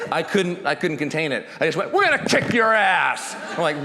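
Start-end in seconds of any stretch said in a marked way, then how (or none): 1.9-3.3 high, loud taunting shout right in his face; ferocious yell. Retold or imitated. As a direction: roar it at him like you could tackle him. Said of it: "like, ferocious".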